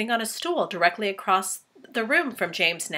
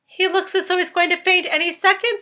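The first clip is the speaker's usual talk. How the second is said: high, louder, put-on creature voice; centipede remarking; 10 semitones higher, 6 dB louder than usual. Centipede telling the others James might faint, high voice. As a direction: comment in a high cheeky centipede voice.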